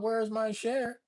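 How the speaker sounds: ordinary talk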